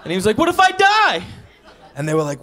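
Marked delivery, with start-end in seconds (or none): 0.4-1.2 high, loud panicked cry; mock panic. Carrot finder afraid to eat it, high panicked shout. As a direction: squeal it in hammed-up terror.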